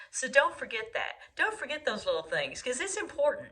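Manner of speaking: ordinary talk, nothing marked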